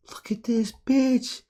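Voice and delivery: high, slow, drawn-out squeal of disbelief; stunned disbelief. Real, not acted. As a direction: let it stretch out high in shock. Disbelief at the trading chart.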